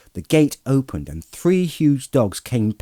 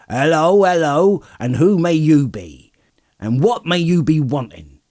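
loud, raised farmer's voice; gruff farmer challenging strangers; 6 semitones higher, 6 dB louder than usual. A narrator's reading. Farmer confronting strangers at his gate, loud and challenging.

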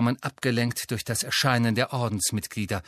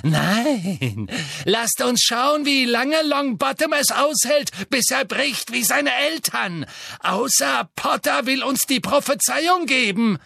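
much higher, louder gleeful menace; Bellatrix thrilled; 11 semitones higher, 4 dB louder than usual. A narrator's reading. Bellatrix threatening Neville, thrilled cruel glee. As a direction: purr with cruel excitement. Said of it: "vibrierte vor Erregung".

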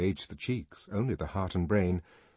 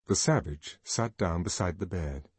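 plain reading voice

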